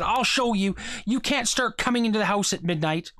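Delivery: mildly angry, pitch up; a father snapping at his late son, lightly angry. A narrator's reading. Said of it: "rushed angrily to meet them".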